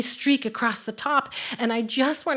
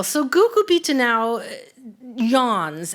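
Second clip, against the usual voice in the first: ordinary talk, nothing marked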